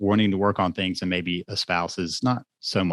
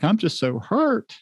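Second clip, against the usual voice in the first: hurt confession; 12 semitones higher, slower than usual. Retold or imitated. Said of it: "And they're like".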